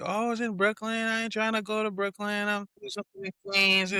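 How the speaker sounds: high, dismissive whiny voice; mocking Queens friends. Mocking friends who refuse to travel, high and whiny. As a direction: mimic friends brushing off a trip. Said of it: "some of my Queens friends like".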